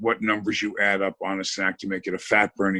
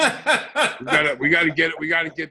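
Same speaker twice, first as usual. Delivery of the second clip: words squeezed out through laughter, high; laughing; 7 semitones higher than usual. Real, not acted. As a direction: laugh your way through the line. Laughing while pitching supplement.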